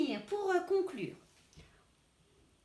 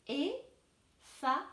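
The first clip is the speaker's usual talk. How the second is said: Effacer said slowly in syllables; slow teacher split of Effacer; much slower than usual. Shown as a demonstration. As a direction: stretch Effacer out. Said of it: "couper le mot".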